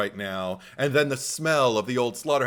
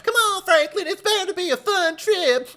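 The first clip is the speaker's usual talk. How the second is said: high, mocking impression of a chirpy sister; squeaky put-on voice of the upbeat sister; imitating Sally; 5 dB louder than usual. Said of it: "he's doing an impression of her".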